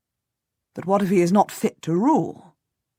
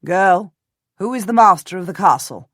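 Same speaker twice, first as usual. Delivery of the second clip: calling out; 7 dB louder than usual. Acted. Calling to girl across river.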